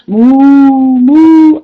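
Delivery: loud, high mooing; playful cow moo for kids. Acted. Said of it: "it's Clara the clarifying cow".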